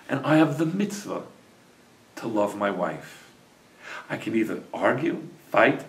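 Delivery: soft, low loving voice; tender sincerity. A narrator's reading. Husband forgives his wife and declares love, soft tender voice.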